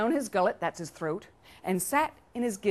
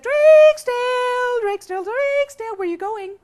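high ladder voice; 10 dB louder than usual. Acted. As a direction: call out in long, high notes as a ladder. Ladder friend calls to Drake's Tale.

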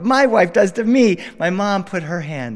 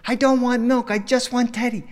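put-on whiny toddler; 9 semitones higher than usual. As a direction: whine it like a stubborn two-year-old. Toddler refusing milk again.

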